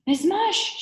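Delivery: put-on high, loud girl's shout. A narrator's reading. Allison shouts to the visitor outside, high urgent shout. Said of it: "shouted Allison".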